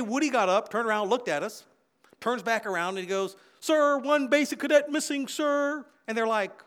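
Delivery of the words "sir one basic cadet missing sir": barked report. Retold or imitated. Woody reporting at attention.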